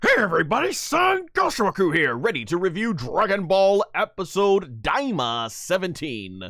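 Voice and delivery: high, loud hyped YouTube greeting; energetic intro. Shown as a demonstration. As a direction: open big and bright like a channel host. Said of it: "Or let me try that over again".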